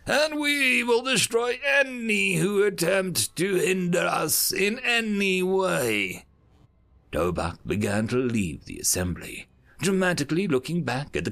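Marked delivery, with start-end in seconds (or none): 0.1-6.3 higher, slow final warning; envoy threatening the whole council; menacing threat. A narrator's reading. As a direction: let the threat land after a pause. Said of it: "paused for effect".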